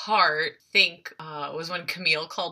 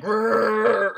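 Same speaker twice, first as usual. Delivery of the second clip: monster growl; 3 semitones higher, 4 dB louder than usual. Retold or imitated. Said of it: "coming at him like a monster".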